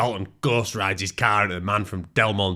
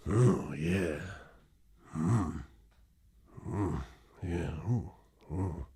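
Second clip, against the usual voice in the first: low moans; 4 semitones lower, 11 dB quieter, much slower than usual. Acted. Moaning scene, low breathy sounds.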